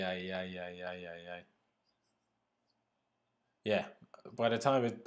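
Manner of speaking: normal talking voice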